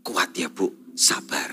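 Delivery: hushed, low consoling murmur; near-whispered pastoral comfort; gentle, soft-spoken. Retold or imitated. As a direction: console very softly, voice dropped low. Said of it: "Pasti halus ngomongnya".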